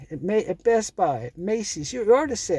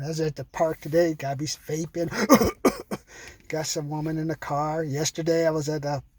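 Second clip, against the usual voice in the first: ordinary delivery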